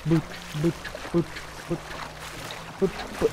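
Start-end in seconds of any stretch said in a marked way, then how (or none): none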